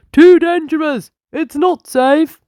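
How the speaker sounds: high, loud, gruff shouting; angry shout. A narrator's reading. Angry yard man shouting at the children. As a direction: chase the kids off with a shout. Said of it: "chased them away with shouts of".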